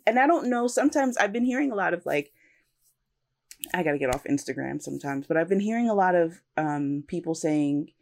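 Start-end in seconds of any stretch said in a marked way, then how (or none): none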